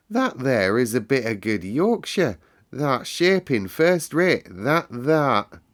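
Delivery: admiring Yorkshire. Acted. Mary delights in Colin's Yorkshire, admiring. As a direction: praise him in broad Yorkshire.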